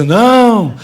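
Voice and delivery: loud indignant shout of no; the whole audience rejects it, loudly; crowd of women shouting. Retold or imitated. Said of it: "mulherio todo levantou assim". (grito indignado das mulheres)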